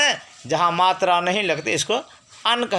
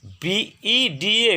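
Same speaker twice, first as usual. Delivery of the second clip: 1 slow spelling; 3 semitones higher, much slower than usual. Shown as a demonstration. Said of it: "जैसे वेद".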